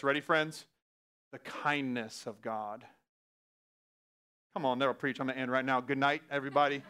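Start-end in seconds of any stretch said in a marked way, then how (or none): 1.3-2.8 "kindness" drawn out, slow and soft; slow emphasis. Shown as a demonstration. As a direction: linger on "kindness", let it land. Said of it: "listen to this".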